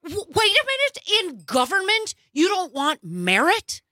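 high-pitched feigned disbelief; stammering, high mock surprise; mock incredulity. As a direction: act baffled by the contradiction. Mocks conservatives rejecting merit after preaching it.

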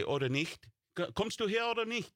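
high German farmer voice. Retold or imitated. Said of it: "they always say".

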